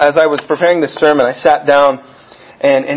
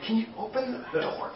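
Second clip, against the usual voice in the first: hushed plea; 6 semitones higher, 14 dB quieter than usual. Retold or imitated. Peter outside pleading.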